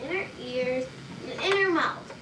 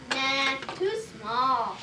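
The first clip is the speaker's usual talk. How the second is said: slow dismissive nah; 3 semitones lower, much slower than usual. Acted. Doctor rejects small needle.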